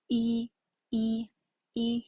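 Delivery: "E, E, E" each held level; three unchanged first-tone "E" sounds. Shown as a demonstration. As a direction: repeat "E" on the same steady pitch. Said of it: "the tone is the first tone".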